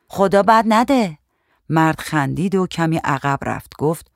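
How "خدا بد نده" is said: hearty raised-voice greeting, higher pitched; loud greeting. A narrator's reading. Painter greets the injured man, loud greeting. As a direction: call out a greeting, moderately loud. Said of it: "نقاش سلام بلندی داد".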